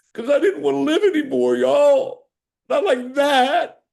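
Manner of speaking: crying harder, climbing high and loud; real sobbing plea, high-pitched; real tearful anguish. Real, not acted. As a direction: plead through real tears, building to a loud cry. Tearfully recalls begging God to die.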